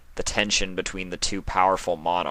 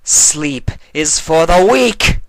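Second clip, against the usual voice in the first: much louder, slow, high declaration; furious shout; 11 semitones higher, 13 dB louder, slower than usual. Acted. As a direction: hurl it as a decree. Nightmare Moon shouts back in argument.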